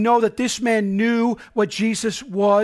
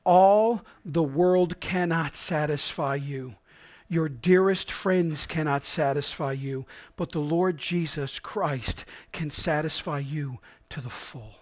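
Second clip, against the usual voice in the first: slow, soft reading; quiet, slow solemn quotation; solemn quote; 6 semitones lower, 6 dB quieter, slower than usual. Acted. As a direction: lay the quote down gently. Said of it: "Charles Spurgeon sums it up so well when he says".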